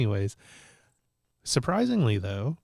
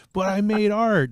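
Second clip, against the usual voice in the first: put-on higher, louder kid insisting; a boy defending his ruined film as art; imitating insistent kid; 11 semitones higher, 7 dB louder than usual. Nephew voiced in a story.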